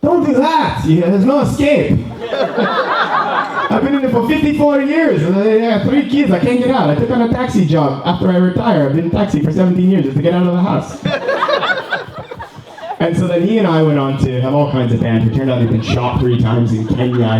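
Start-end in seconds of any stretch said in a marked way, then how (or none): 0.0-2.0 put-on high, laughing old cabbie voice; lightly mocking old Italian man, laughing through it; old Italian taxi driver. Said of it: "He said". 2.0-3.7 cabbie's laugh. Retold or imitated. Cabbie laughing in retold story. 3.7-11.0 put-on high, laughing old cabbie voice; lightly mocking old Italian man, laughing through it; old Italian taxi driver. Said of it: "He said". 11.1-12.9 cabbie's laugh. Retold or imitated. Cabbie laughing in retold story.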